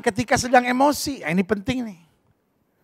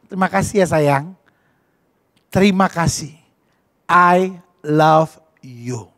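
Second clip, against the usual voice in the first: lower, slow loving words; tender thanks; 5 semitones lower, slower than usual. Shown as a demonstration. Parent hugging the child, low and tender. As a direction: say it with a hug. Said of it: "peluk dia dan bilang".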